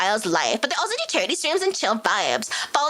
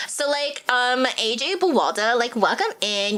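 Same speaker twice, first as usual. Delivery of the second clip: put-on slow Valley Girl accent; slow Valley Girl welcome. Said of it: "in Valley Girl voice because somebody asked for it".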